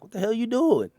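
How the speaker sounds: high, loud mock yell; exasperated yell. Coworker yelling at driver, loud and high. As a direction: retell the coworker's disbelief, loud.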